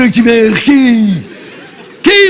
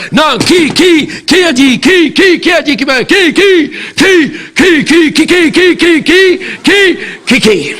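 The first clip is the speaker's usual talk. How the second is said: owl hoot; 3 semitones higher than usual. Acted. Owl-like repeated qui.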